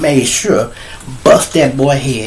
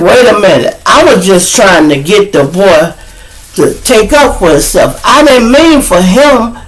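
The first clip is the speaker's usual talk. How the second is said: high, louder self-defense; indignant protest; 7 semitones higher, 4 dB louder than usual. Retold or imitated. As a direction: plead your case, stressing each phrase. Acted protest when accused.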